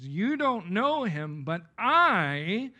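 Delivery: slow, with "I" punched loud and long; stress on "I". Shown as a demonstration. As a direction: contrast them with yourself, hitting "I" hard. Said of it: "he did so for emphasis".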